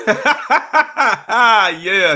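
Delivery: loud, high belly laugh falling into "yeah"; real hearty laughter. Real, not acted. Host agreeing with caller who says she's country, laughing heartily. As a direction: burst out laughing, then agree through it.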